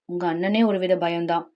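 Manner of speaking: low, soft mutter to oneself; muttered aside. Acted. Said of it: "இப்ப என்ன சொன்னீங்க?". (தாழ்ந்த குரலில் முணுமுணுப்பு)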